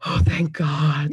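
slow, breath-out relief; re-enacted sigh of thanks; acted relief. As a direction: let it out slowly, hugely relieved. Retold relief at bleeding.

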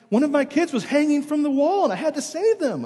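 high, urgent imitation of wife; frantic explanation. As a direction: blurt it out in mock panic. Voices wife's frantic explanation afterwards.

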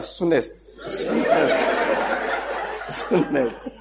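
repeated through his own laughter; dissolving into laughs while mimicking; laughing mock answer. Storyteller mimicking a visa applicant's reply, laughing.

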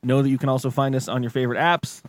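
usual speaking voice

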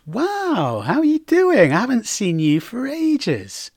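high, swooping delighted astonishment; light up with friendly surprise; pleasantly surprised. Shown as a demonstration. Greeting a friend not seen for ages, surprised. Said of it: "to express surprise".

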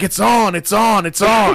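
hyped-up retold call to a fight; mock fight challenge. Imitating a mechanic bouncing like a prize fighter, fired-up challenge.

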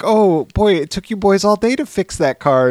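hammed-up doting mother; cooing mom. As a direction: praise the boys like a sweet mom. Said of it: "then your mom's like".

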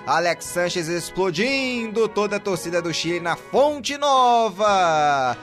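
high, loud, drawn-out praise for the scorer; explosive goal commentary. Real, not acted. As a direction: savor the goal loudly. Goal celebration continues.

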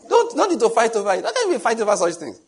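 high, loud, laughing wife's complaint; mock quarrelling wife. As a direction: play the nagging wife, half-laughing. Acted couple quarrel, wife's voice.

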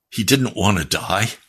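ordinary delivery

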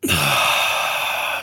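long breathy sigh; cleansing exhale. Shown as a demonstration. Host shows listeners a cleansing breath, long exhale. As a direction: let the whole breath out slowly. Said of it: "it's a cleansing breath".

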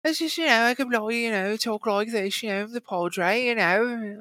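put-on high-pitched wacky English accent; Russell Brand. Showing he could copy the departed actor, high wacky voice. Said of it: "imitate that guy's voice".

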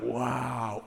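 soft, low awed wow; hushed wow. Retold or imitated. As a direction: breathe out the wow in awe. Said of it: "I was like".

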